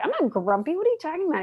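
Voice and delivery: high-pitched indignant protest; grumpy denial. Acted. Grumpy monkey answers his friends, indignant. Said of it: "why are you so grumpy".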